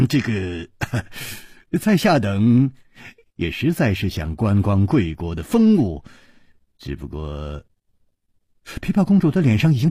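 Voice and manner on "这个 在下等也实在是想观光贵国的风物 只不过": awkward, halting politeness trailing away; apologetic chuckle. A narrator's reading. Guest wriggling out of a royal invitation, awkwardly apologetic. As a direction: clear the throat and hedge with a small placating laugh. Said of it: "陪笑着说".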